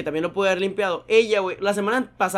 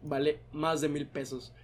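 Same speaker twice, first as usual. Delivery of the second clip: low, soft, measured reproach; stern lecturing; 5 semitones lower, 11 dB quieter than usual. Retold or imitated. Manager tells barista the broom's price, quiet stern reproach.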